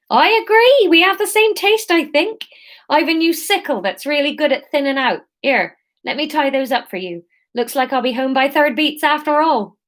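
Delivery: high, bright-pitched Somerset seller agreeing; Somerset accent. Acted. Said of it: "this is gonna be Somerset".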